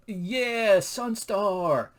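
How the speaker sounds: high, loud drawn-out fan cheer; whooping like a thrilled fan; excited cheer. Retold or imitated. Acting a fan's cheer.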